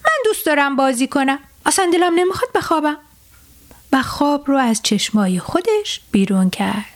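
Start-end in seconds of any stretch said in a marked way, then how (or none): 0.0-3.0 put-on fast, higher protesting little boy; sleepy stubborn boy. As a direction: push back against sleep, rushing. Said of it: "به زور باز نگه داشت و گفت". (پسرک لجباز، تند و زیر)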